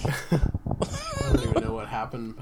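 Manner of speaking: slow, worn out from real laughter; laughing to tears. Real, not acted. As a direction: recover from a fit of teary laughter. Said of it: "My eyes are filled with tears".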